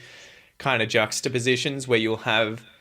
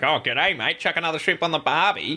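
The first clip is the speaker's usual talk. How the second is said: hammed-up stock Australian accent, higher; mock Aussie accent; 6 semitones higher than usual. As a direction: play the cliché Aussie tourist line in a mock Australian accent. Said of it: "If you're like".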